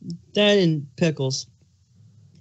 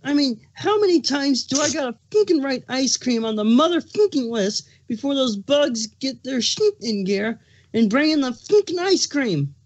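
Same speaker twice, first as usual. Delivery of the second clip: higher, ranting fury at the useless bugs; angry rant; 6 semitones higher than usual. Acted. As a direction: spit out every curse, furious and fed up. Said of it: "his plastered with anger".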